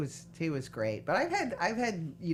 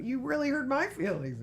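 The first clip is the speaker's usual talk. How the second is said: much higher, hammed-up wounded voice; exaggerated injured complaint; mock hurt; 10 semitones higher than usual. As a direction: imitate someone whining about being hurt. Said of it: "My feelings were really hurt".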